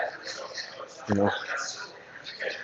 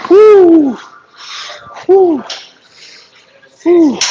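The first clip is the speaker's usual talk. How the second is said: loud, high celebratory whoop; 10 dB louder, much slower than usual. Real, not acted. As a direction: let out a big triumphant woo. Cheering after set.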